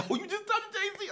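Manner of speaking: very high, laughing squeal of awe; friends' giddy voice. Retold or imitated. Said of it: "Dog and them was like".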